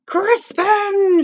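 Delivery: loud, high, drawn-out cry of the name; scolding parental yell; father's shout. Acted. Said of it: "shouted his father".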